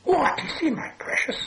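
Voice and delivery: hissed creature whisper, talking to itself; whispering Gollum. A narrator's reading. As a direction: whisper with long hissing s sounds. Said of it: "whispered Gollum".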